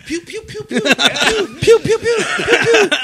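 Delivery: rapid-fire high-pitched pew sounds; mimicked pew-pew noises. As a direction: imitate a friend's teasing toy-gun pews, very high. Imitating friend's mocking pew noises.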